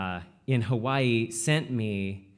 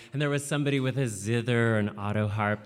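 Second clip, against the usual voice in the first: ordinary delivery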